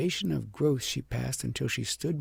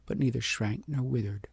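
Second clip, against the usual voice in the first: ordinary delivery, nothing marked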